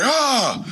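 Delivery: put-on sudden high yell, like a frustrated teen; a short mock roar of frustration; angry roar. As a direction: imitate a big kid roaring in frustration. Angry teen pounds table.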